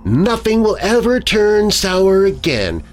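high, sinister taunt; menacing woman, pitched high; sinister hiss. A narrator's reading. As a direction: taunt with a sneer, slowing toward the end. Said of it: "she hissed at me".

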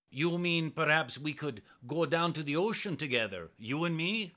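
cautious Italian man. A narrator's reading. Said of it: "asked Luigi cautiously".

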